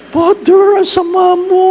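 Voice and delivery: put-on high, loud huffy old lady; playfully outraged old woman; mock scolding old woman. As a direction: squeal it like an offended grandma.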